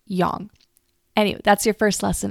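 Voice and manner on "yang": yang as "yahng"; demonstrated pronunciation. Shown as a demonstration. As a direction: say yang with an open, rounded "ah". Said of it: "is pronounced yang".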